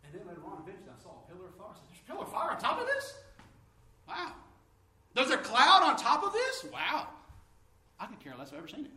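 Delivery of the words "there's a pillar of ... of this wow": amazed. Retold or imitated. Seeing the pillar of fire in the picture, high amazed wonder. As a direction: gasp it out in wonder.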